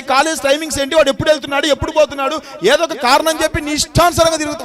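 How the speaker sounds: loud, high, rapid-fire questioning; a preacher on a roll, loud and driving; preaching at full force. Acted. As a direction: fire question after question at the parents.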